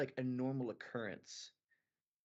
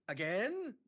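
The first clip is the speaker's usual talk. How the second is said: high, rising incredulous AGAIN; higher exasperated question; 5 semitones higher, 4 dB louder than usual. Pretend. Brother to experimenting sister, high exasperated AGAIN. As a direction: push AGAIN up high in disbelief. Repeated reply, pitched higher.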